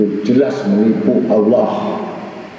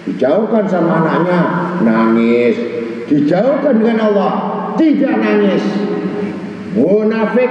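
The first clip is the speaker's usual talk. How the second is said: scornful rebuke; 6 semitones higher than usual. Acted. Rebuking those who do not cry.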